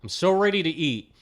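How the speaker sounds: usual speaking voice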